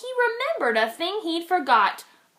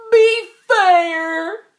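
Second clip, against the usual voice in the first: higher, louder plea with a crack of tears; begging, choked up; 6 semitones higher, 8 dB louder than usual. Acted. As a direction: beg with a lump in your throat. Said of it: "begged with a lump in his throat".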